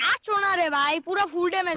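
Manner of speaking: loud, urgent call to a teammate; excited shout. Real, not acted. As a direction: yell it out mid-fight. Urging teammate in a firefight.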